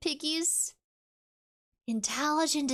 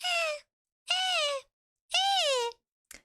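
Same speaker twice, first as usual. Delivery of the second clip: very high little squeaks; high mock creature yawns; tiny creature yawns; much slower than usual. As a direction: squeak like a tiny serpent yawning. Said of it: "the little thing's going".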